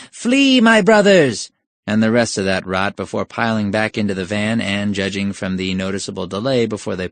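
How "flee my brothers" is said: high, loud mock rallying cry; on-cue shout. Thugs fleeing to the van, hammed-up shout. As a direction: call the retreat high and loud. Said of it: "who on cue shouted".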